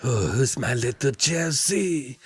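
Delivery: slow, soft pet-talk coo; baby talk. Pretend. Teasing cohost in soft slow baby-talk coo. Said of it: "I'm trying to get you to wake up".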